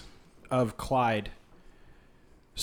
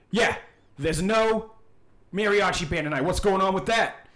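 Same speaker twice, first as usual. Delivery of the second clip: high, raised-voice querying; indignant complaint; 6 semitones higher, 4 dB louder than usual. Acted. Clyde complaining about the missing band, high and indignant. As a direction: demand an explanation, pitched up.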